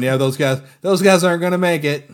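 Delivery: loud, rising pitch, emphatic; animated doom verdict. Retold or imitated. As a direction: call it out like a hyped-up spectator. Voicing viewer's excited verdict.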